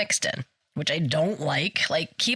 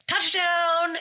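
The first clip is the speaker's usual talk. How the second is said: ref's call; 11 semitones higher than usual. Retold or imitated. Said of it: "the ref is like".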